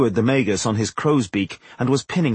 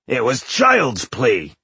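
slow, drawn-out boast; swaggering dwarf boast; boastful; slower than usual. A narrator's reading. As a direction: brag it, stretching the key word. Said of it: "he boasted".

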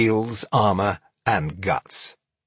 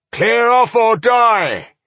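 high, loud, slow menace; threatening dwarf; 13 semitones higher, 7 dB louder, slower than usual. Acted. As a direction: draw out the death threat. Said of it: "their leader threatened".

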